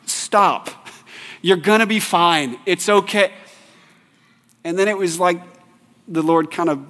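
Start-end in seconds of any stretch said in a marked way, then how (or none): 0.1-3.3 mildly annoyed parent. Retold or imitated. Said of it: "we're kinda tired and mad".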